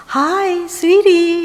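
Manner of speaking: high, sing-out sweetheart greeting; hammed-up loving hello; American-style endearment. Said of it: "미국의 좋은 문화 좀 가지세요".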